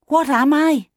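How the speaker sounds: put-on high young chick's voice; questioning chick. A narrator's reading.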